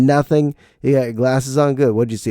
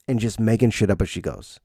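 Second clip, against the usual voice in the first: normal talking voice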